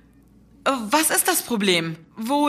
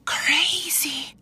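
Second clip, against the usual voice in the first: whisper; 5 semitones higher than usual. Acted. Aside to herself.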